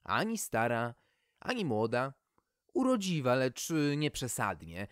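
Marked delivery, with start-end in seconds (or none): none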